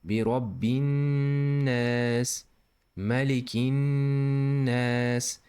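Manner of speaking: rabbin nas slow, with the nn nasal; ghunna. Shown as a demonstration. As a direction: hold the nn in nas through the nose. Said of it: "the same on these words also".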